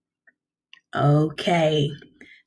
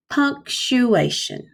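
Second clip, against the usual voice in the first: slow, higher, syllable by syllable; said slower; 5 semitones higher, much slower than usual. Shown as a demonstration. Teacher breaking down a tough word for kindergarteners, slowly. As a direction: stretch each syllable for the class. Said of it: "Try that with me".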